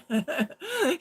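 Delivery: high, loud laughing burst; burst of laughter. Real, not acted. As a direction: let a real laugh break out. Laughs recalling impressed officers.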